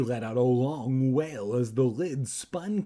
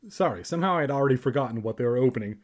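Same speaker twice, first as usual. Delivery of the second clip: ordinary talk, nothing marked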